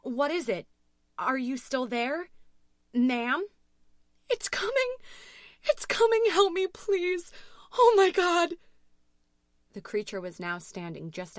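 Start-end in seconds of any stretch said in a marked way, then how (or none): 0.0-3.5 higher, louder, urgent questions; alarmed dispatcher. A narrator's reading. Dispatcher losing touch with Louise, alarmed. 4.3-8.5 very high, loud shrieks; terrified scream. A narrator's reading. Louise begging dispatcher as creature approaches, hysterical. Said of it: "screamed into her phone".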